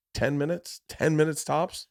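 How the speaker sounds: ordinary talk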